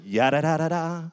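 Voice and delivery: light imitation of a rapper's jingle. Imitating the sound on a cat video, mock rapper. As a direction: toss off the nonsense syllables in another voice. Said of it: "then you hear Snoop".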